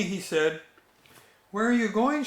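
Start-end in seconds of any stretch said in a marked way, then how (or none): 1.5-2.2 a higher, lightly voiced little girl asking; a small cub asking her papa where they go; Sister's curious question. A narrator's reading. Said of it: "she wanted to know".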